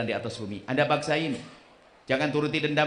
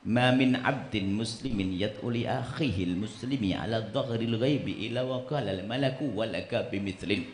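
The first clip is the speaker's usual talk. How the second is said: slow, low reciting in Arabic; Arabic hadith recitation; 5 semitones lower, 6 dB quieter, slower than usual. Acted. Preacher quoting the Prophet in Arabic, slow recitation. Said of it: "Nabi bersabda begini".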